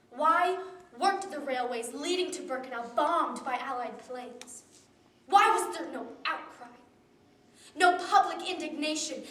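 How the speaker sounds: rising to a loud, pleading demand; impassioned outcry. Real, not acted. Rhetorical outcry in memorial speech.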